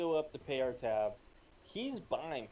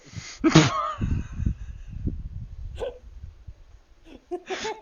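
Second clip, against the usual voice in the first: long high laughing fit; 13 dB louder than usual. Real, not acted.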